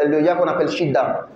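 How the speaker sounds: ordinary talk, nothing marked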